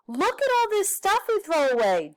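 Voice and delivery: put-on high, eager girl voice; excited girl voice. Judy showing her family the trash, high and eager. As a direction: point it out with a kid's outrage.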